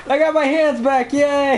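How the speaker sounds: high, gleeful cheer through laughter; excited. Real, not acted. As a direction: squeal it with delight. Delighted to have hands back.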